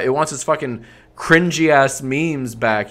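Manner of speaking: normal talking voice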